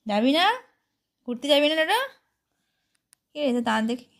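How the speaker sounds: ordinary delivery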